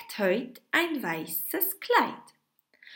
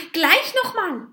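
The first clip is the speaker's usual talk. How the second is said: higher, louder, eager call; 11 semitones higher, 8 dB louder than usual. Acted. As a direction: urge it on with delight. Witches eager to repeat spell.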